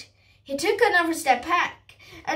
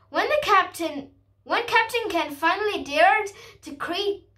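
ordinary delivery, nothing marked

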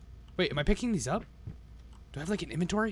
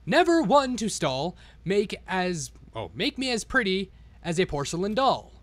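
1 storybook reading; 5 semitones higher, 5 dB louder, slower than usual. A narrator's reading. Reads the storybook rhymes.

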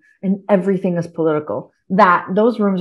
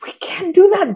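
urgent whisper, pitched up; whispered warning; 9 semitones higher than usual. Retold or imitated. Warning her friend in the office, urgent whisper. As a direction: hiss it so nobody in the office hears.

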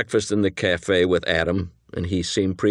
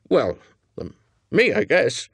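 higher, hesitant confession; sheepish admission; 5 semitones higher than usual. Acted. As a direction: own up reluctantly, haltingly. Cornered accomplice admits his blunder.

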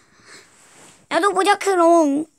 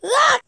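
scream; 7 semitones higher than usual. Acted. Character knocked out suddenly.

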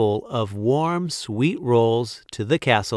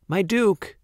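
high, imploring address; pleading; 8 semitones higher than usual. A narrator's reading. Baker begs the Duke not to banish him, pleading. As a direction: appeal for mercy. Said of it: "pleaded Walter".

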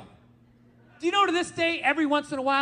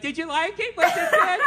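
higher, laughing, needy check-in; mock eager wife; 6 semitones higher, 5 dB louder than usual. Wife hovering over husband, mock eager laughing. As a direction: play the clingy wife asking if he liked it.

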